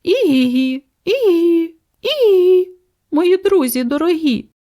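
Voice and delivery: high whinnying zebra call; zebra's cry. A narrator's reading. Lady zebra phoning her dear friends, high whinnying cry. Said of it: "закричала".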